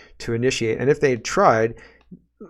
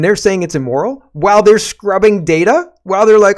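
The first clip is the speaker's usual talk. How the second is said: loud, raised, incredulous rant; indignant; 9 semitones higher, 10 dB louder than usual. Real, not acted. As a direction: push it high and loud in outrage. Indignant rant against a double standard.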